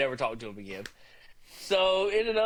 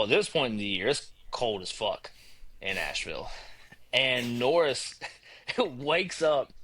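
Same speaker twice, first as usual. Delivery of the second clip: usual speaking voice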